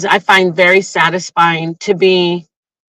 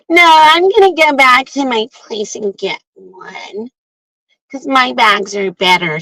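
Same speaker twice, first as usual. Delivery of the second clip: mocking refusal; 3 semitones higher than usual. Mocks the girl refusing her bag.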